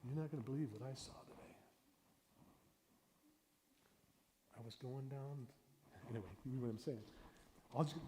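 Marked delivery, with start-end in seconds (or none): 0.0-5.5 fast, soft intrigue; hushed teaser. Shown as a demonstration. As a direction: lean in and tease the kids quietly. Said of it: "you just say".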